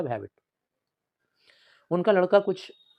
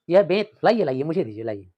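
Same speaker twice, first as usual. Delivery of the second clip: grin and fuss over the guest; overly eager smile; 2 semitones higher, 8 dB louder than usual. Acted. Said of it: "थोड़ा ज़्यादा ही मुस्कुरा रहे हैं".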